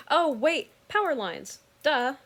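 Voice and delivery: high, loud "oh wait" of sudden insight; retold startled realization. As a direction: catch yourself mid-mistake, loud and high. Sudden realization about power lines.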